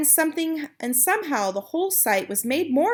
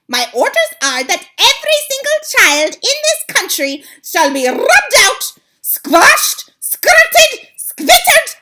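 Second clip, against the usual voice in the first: very high, loud, cackling shriek; a furious witch rejects her coven; witch's shriek; 13 semitones higher, 14 dB louder than usual. Acted. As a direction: shriek her scorn at them. Witch gives her orders.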